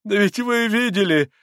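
loud, high appeal; distraught pleading exclamation; desperate protest. A narrator's reading. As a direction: protest helplessly, voice pitched high. Father points desperately at his poverty.

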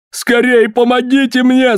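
high, stretched-out panicked cry; frantic high wail; wailing for help. A narrator's reading. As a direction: cry out desperately, pitch right up. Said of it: "завопил Коллинз".

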